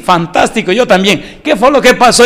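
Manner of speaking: high, loud delighted little voice; the baby bursting with joy; happy baby voice. Pretend. Unborn baby delighted that mommy is happy, high loud voice.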